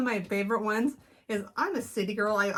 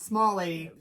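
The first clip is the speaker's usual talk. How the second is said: ordinary delivery, nothing marked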